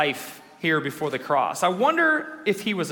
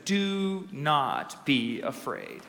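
slow, low, soft, each word apart; gentle reassurance; 4 semitones lower, 6 dB quieter, much slower than usual. Retold or imitated. Preacher giving God's word to the fearful, gently reassuring.

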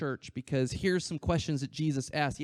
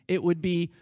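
ordinary talk, nothing marked

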